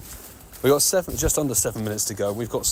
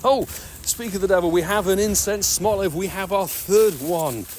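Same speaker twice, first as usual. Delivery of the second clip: excited; 7 semitones higher than usual. Real, not acted. Shiny Pokemon appears on stream.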